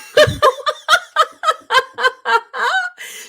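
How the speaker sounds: long, high burst of laughter; real laughing fit. Real, not acted. Laughing at the scene.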